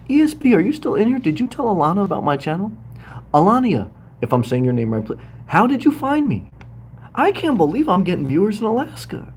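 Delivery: high, eager questions tumbling out; excited. Real, not acted. Livestreamer excitedly asking how the viewer found him. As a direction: keep the pitch up, delighted.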